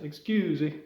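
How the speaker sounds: sing-song Italian "excuse me"; Italian accent. Acted. Student at the back seeks attention, Italian accent. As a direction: put on a cartoon Italian accent. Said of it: "that's the way you do an Italian accent".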